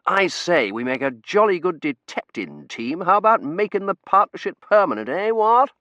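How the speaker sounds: jolly upper-class sleuth proposing; posh gentleman detective. Retold or imitated. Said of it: "Dorothy Sayers, the detective hero saying".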